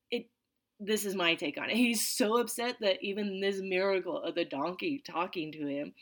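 ordinary delivery

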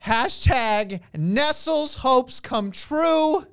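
slow, drawn-out triumphant proclamation; excited. Real, not acted. Podcast host announces his hopes came true, excited. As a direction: draw out every word like a victory announcement. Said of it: "because I'm so excited".

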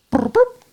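very high burbling radio chirp; radio noise. Acted. Game master mimics the radio for players, high chirpy noise. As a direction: imitate a radio going off, high-pitched. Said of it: "you hear the radio go".

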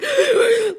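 sharp high gasp for air; imitated panicked gasp. Recalling sobbing into the pillow, one high gasp. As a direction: act out hyperventilating with one gasp. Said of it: "like, hyperventilating".

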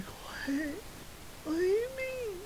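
high, squeaky disbelief; mock bewilderment. Reacting to news of a hurricane, squeaky bewilderment. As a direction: play baffled in a tiny high voice.

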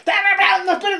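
high, loud, hammed-up exorcist shout; preacher parodies a screaming exorcism; mock shouted rebuke. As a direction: overdo a yelled rebuke to mock it. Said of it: "que Jesús clamó a gran voz".

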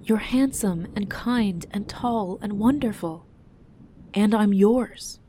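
slow, earnest list of praise; heartfelt, drawn-out declaration; adoring insistence. A narrator's reading. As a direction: plead your love, drawing out each quality. Wife praising husband, pleading her love.

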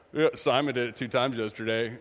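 lightly voiced boy ribbing his friends; teenage boy. Disciples needling Simon about yesterday, low teasing. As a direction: drop low, a snickering aside between boys. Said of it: "ears of a thirteen, fourteen-year-old boy".

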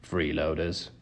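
low, soft grumble; grumbling. A narrator's reading. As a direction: mutter it in disgust.

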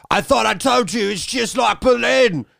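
high, slow, shouted villain quote; Ray Winstone impression. Mocking the movie's double-crosser with a high shout.

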